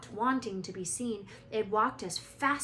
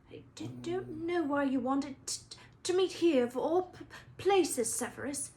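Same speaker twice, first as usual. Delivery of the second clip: higher, stammering, frightened Quirrell; nervous stutter; 6 semitones higher, 4 dB louder than usual. Acted. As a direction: stumble over every word in fear. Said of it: "he was stuttering worse than ever".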